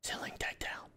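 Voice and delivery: whispered call; stealth whisper. Real, not acted. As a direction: hush the word out. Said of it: "stealth whisper".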